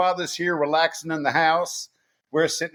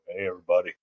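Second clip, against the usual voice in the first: much lower, softer voice-cracked greeting; Peter Brady; 10 semitones lower, 4 dB quieter, much faster than usual. Retold or imitated. Teasing the guest's changing voice, deep cracked greeting. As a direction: drop into a sudden deep broken voice. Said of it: "He's doing Peter Brady on us".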